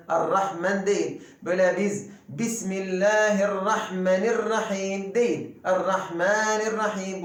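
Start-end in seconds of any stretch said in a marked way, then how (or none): none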